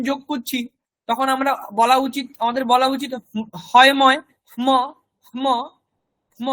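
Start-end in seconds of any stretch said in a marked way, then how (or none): 3.7-6.5 উচ্চারণ ভেঙে ভেঙে; spelled-out pronunciation. Shown as a demonstration. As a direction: sound out হয় ময় as h before m, then repeat ম. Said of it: "এরকম বলা উচিত".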